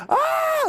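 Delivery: short, very high-pitched shriek; high demo shriek; demonstrated shriek. Shown as a demonstration. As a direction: let out a sustained high "ah" like a shriek. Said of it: "like a shriek".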